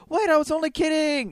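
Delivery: high, hammed-up plea; mock pleading prisoner. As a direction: beg to go back up the cross. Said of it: "He's like".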